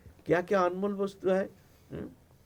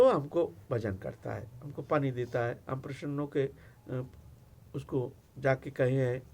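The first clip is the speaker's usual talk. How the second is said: quiet confiding Shiva; 5 semitones lower, 8 dB quieter than usual. Acted.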